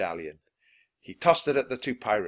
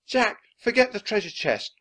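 high, louder plea to leave; urgent cry; 11 semitones higher, 5 dB louder than usual. A narrator's reading. Annie begs Jack to leave the chest, crying out. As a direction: cry out to drag someone away. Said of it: "cried Annie".

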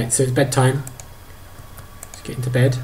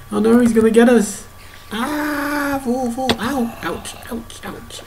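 mock alarm; 12 semitones higher, slower than usual. Chased in game, playful alarm.

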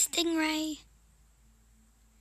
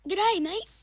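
Aussie greeting; 5 semitones higher, 7 dB louder than usual. Pretend. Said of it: "They should call me Shane Irwin".

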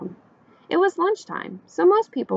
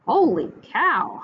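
higher, stretched-out holler; 7 semitones higher, 4 dB louder, slower than usual. A narrator's reading. Cook seeing animals pass the diner, hollering. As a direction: draw it out in mild astonishment. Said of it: "hollered Manny the cook".

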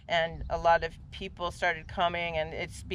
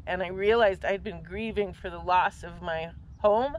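ordinary delivery

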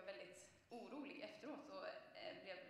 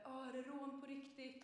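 imitated calling out; 6 semitones higher than usual. Group of guys by a bus calling to the robbed runner, mildly shouted. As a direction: call it out lightly, imitating startled bystanders. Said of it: "och de ropade".

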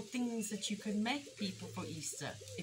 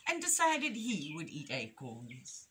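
lilting, emphatic picture-book reading; story reading to children; 4 dB louder than usual. A narrator's reading. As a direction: read it expressively to little listeners.

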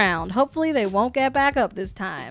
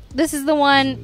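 usual speaking voice

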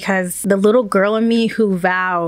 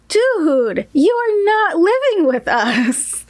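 high-pitched, drawn-out mock exasperation; hammed-up exasperated put-down; exasperated refusal; 10 semitones higher, much slower than usual. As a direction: retell an emphatic refusal, stretching the words high. Retold exasperated refusal to friend.

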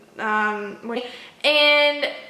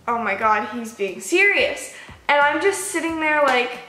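usual speaking voice

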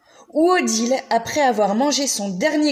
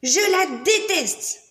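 slow, higher sulky whine; grumpy child; 7 semitones higher than usual. Acted. Odile refusing her scarf, slow sulky whine. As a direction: drag it out like a sulking little girl. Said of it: "dit Odile".

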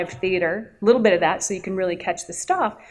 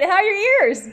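high, teasing retort; cheeky comeback; 13 semitones higher, 4 dB louder than usual. Retold or imitated. Teasing the sound guy after the scream, high and cheeky. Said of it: "I'm like".

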